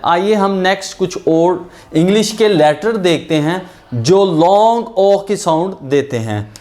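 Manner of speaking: ordinary talk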